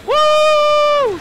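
high, loud whoop; sustained high cheer; excited woo. Real, not acted. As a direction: let out a long, ringing "woo". Cheering during game play.

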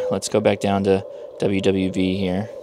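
ordinary talk

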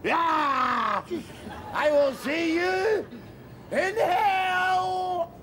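high, slow shout, laughing through it; defiant shout. Acted. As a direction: fling it out as a last defiant cry.

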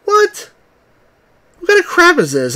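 a sudden high squeak of disbelief; shocked what. Real, not acted. Baffled by precise game input.

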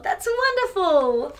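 usual speaking voice

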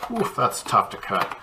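ordinary talk

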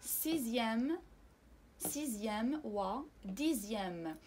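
سيزيام and ديزيام drawn out with a clear z; French سيزيام, slow voiced z; slow z sound. Shown as a demonstration. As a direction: stretch the z in سيزيام. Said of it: "الصوت هو ز".

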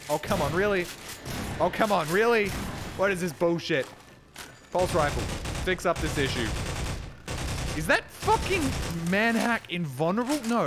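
loud, high-pitched gamer outburst; exasperated yelling. Real, not acted. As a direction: shout in disbelief at an unfair game. Frustrated by a game enemy.

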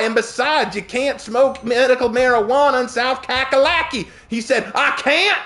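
very high, exasperated Southern argument; exaggerated Southern accent. Server arguing the law with a customer, exaggerated Southern. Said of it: "I'll stop with my southern accent".

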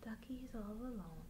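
sighing hushed whisper; sad whisper. A narrator's reading. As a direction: whisper that he's lonely. Sad moment, narrated to baby.

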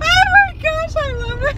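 high, breathless through laughter; gasping it out mid-laugh. Real, not acted. Helpless laughter at Siri.

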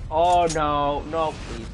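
loud, desperate begging; loud pleading. Real, not acted. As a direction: beg loudly in dismay. Gamer dreading robots.

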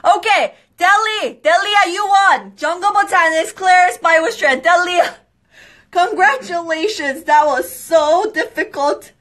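high, loud celebratory calling; excited announcement. Real, not acted. Host loudly congratulating the winning viewer. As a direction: cheer the winner's name out.

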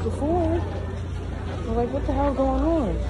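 muffled by cloth mask; speaking behind mouth covering; through a face mask. Real, not acted.

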